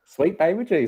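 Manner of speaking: put-on Southern accent, preaching Sweet baby Jesus; Southern preacher accent. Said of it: "You almost sound like a preacher".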